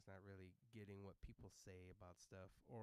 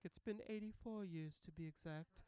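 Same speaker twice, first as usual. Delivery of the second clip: Rose from the Titanic; 8 semitones higher, 6 dB louder than usual. Retold or imitated. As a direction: draw it out like an aged film heroine. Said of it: "turned into Rose from the Titanic".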